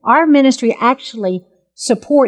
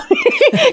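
squealing laugh, much higher. Real, not acted. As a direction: burst out laughing in disbelief. Laughs at sudden ticket news.

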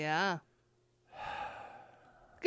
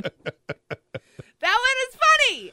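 real laughter, high, loud and very slow; words squeezed out through a laugh; cracking up while talking; 11 dB louder, slower than usual. Real, not acted. Laughing at the kelp joke.

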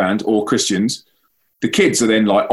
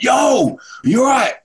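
much higher, light calling out in the dark; concerned call; 14 semitones higher, slower than usual. Retold or imitated. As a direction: check on her from a distance.

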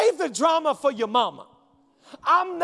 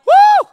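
high whooping cry of excitement; joyful woo; 15 dB louder than usual. Real, not acted. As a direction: let out a wild high woo. Preacher's exultant shout after the point.

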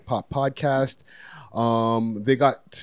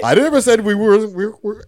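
loud protest; 10 semitones higher, 8 dB louder than usual. Real, not acted. Protesting loudly.

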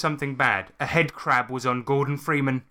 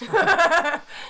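loud laugh; 4 dB louder than usual. Real, not acted. Bursts out laughing.

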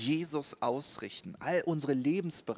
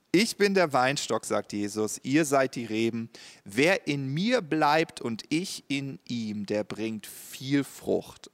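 solemn scripture reading; 4 dB louder than usual. Shown as a demonstration. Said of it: "ich lese den Vers jetzt mal zu Ende vor".

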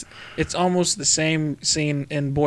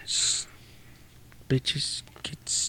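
whispered threat; 11 dB quieter, much slower than usual. Real, not acted. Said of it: "I said bitches".

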